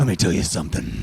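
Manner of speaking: low wrestler promo voice; Macho Man Randy Savage. Acted. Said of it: "I gave it a Macho Man Randy".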